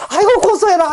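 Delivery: 높이 치켜올린 고소한 비웃음; 남의 망신을 고소해하며 크게 비웃기; 고소하다는 조롱. Acted. 천사들이 비웃으며 박수. (천사들이 들통난 자를 비웃는 고소한 조롱)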